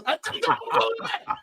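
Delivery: half-amused scolding of a brother who called back; laughing exasperation. Retold or imitated. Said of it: "I'm like this".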